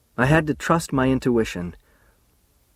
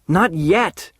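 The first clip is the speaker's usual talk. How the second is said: "Not yet!" thrown back loud and higher; shouted; 7 semitones higher, 3 dB louder than usual. A narrator's reading. Charlie shouting her words back at Alice. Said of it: "I shouted".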